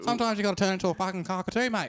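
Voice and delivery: quick, high Aussie farmer line; Aussie farmer cracking a joke. Acted. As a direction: rattle it off like a cheeky outback bloke. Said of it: "That's pretty good, that one".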